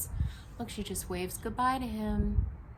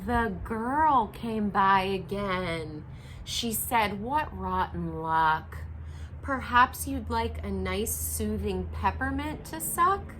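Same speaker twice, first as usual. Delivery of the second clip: story narration; slower than usual. A narrator's reading. Reading picture book aloud.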